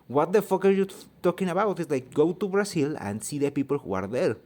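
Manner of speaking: high, loud retold outburst; indignant reaction. Mexican streamer recalls reacting to gatekeepers, indignant.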